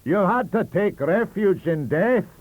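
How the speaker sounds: slow, loud gloating Scots; triumphant. Acted. Librarian gloating over Le Pan, loud and slow. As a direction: savour the win. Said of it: "he triumphed".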